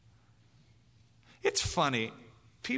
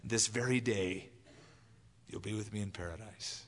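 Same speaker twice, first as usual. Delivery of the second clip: tender promise; 5 semitones lower, 6 dB quieter than usual. Retold or imitated. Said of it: "Jesus said".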